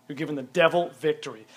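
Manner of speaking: ordinary talk, nothing marked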